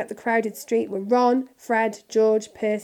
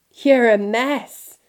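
loud, slow gruff scolding; gruff giant telling someone off; 3 semitones higher, 8 dB louder, much slower than usual. A narrator's reading. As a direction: draw it out gruffly and loudly. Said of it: "said Hagrid gruffly".

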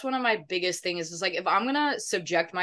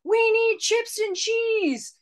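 high, drawn-out mock holler; retold yell; 4 dB louder, slower than usual. Friend retelling yelling at the drive-thru lady, urgent mock yell. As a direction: yell an urgent order across someone. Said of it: "I literally yelled at the lady across Zach".

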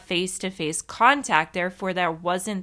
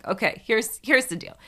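normal talking voice

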